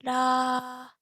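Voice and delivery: steady mid-pitch sung la; medium pitch la. Shown as a demonstration. Teacher showing students the medium pitch, a sung la. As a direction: hold a level middle note on la. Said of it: "locating the medium pitch".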